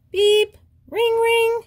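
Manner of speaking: siren imitation. Nellie imitating machine sounds and a siren for friends, high and loud. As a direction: mimic a siren for fun, wailing wee-oo. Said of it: "She laughs at her imitation of a siren".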